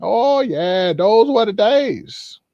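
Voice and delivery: high, wistful sigh of an elder; slow, high fond reminiscing; wistful old-timer. Acted. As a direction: savour the memory like an old man. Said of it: "they might have said some things like".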